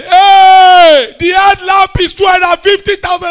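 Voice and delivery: high, very loud outraged cry; screaming outrage. Acted. Onlookers to the boy over a broken headlamp, screaming outrage. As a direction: scream over the costly damage. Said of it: "for you to be screaming here".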